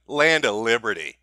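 normal talking voice

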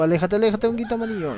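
worried call to the yellow mushroom; urgent warning. Real, not acted. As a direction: shout a warning to your buddy. Warning the mushroom ally away.

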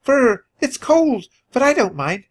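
put-on high, childlike little mouse; shivery start, then cheery high chatter; cheerful squeak. A narrator's reading. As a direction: pipe up happily like a small nephew. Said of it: "he squeaked cheerfully".

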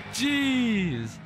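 high, drawn-out startled yelp; jolted by a near miss; startled cry. Real, not acted.

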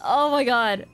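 ordinary talk

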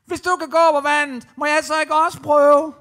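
loud, high call across the water; eager shout. Acted. Peter calls to Jesus asking to try walking too, loud. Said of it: "Så råber Peter nemlig".

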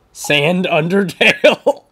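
loud, high, drawn-out question; amused disbelief. Real, not acted. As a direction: read the name out in incredulous amusement. Amused disbelief at a player's name.

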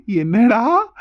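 slow, chuckling teacher's query; amused laughing. Acted. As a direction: laugh first, then a drawn-out question. Teacher amused by the child's complaint.

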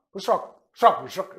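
"Pushok" with a sound close to ch, but not quite; "Pushok", a soft sound near ch, trailing off quietly; pronunciation demo. Shown as a demonstration. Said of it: "C'est proche d'un ch". ("Pushok" avec un son proche du ch, mais pas tout à fait ch)

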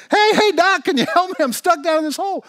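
high-pitched, loud shout to get attention; yelling for help. Acted. Trapped man spots a doctor walking by, yelling. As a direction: holler urgently up at someone passing. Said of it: "Bob keeps yelling".

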